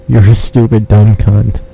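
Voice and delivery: ordinary talk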